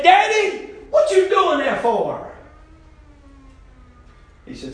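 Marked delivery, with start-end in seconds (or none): 0.0-2.3 put-on curious child. Son asks father at Passover.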